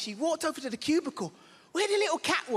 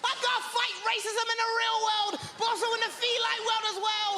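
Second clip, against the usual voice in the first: high, worked-up outraged rant; raised, fired-up complaint; indignant rant; 9 semitones higher than usual. Retold or imitated. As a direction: protest it, getting more and more indignant. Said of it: "I was like".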